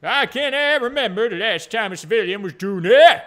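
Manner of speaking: loud, hammed-up Scottish accent; funny Scottish voice. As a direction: go all in on a bad, blustering Scottish burr. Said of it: "does he talk a little bit funny?".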